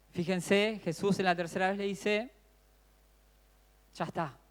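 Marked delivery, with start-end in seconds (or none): 3.9-4.4 un "Ya está" muy bajo, casi apagado; suave y resignado. Acted. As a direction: dilo bajito, como quien acepta el final. Jesús habla a discípulos dormidos. (Jesús a sus discípulos dormidos, en voz baja y resignada)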